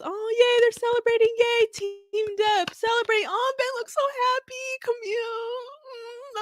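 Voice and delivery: high, gushing happy coo; overjoyed cooing. Real, not acted. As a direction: coo it high, moved by their celebration. Moved watching the lawyers celebrate.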